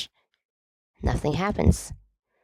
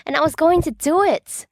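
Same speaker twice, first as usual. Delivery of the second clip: exasperated outburst; 10 semitones higher, 4 dB louder than usual. A narrator's reading. Sirius exasperated at James.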